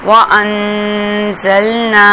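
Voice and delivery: slow, sung tajweed chant; melodic recitation. Shown as a demonstration. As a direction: draw the words out melodically. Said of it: "teacher recites joined word". (ترنم کے ساتھ آہستہ تلاوت)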